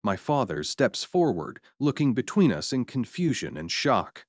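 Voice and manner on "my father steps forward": ordinary delivery, nothing marked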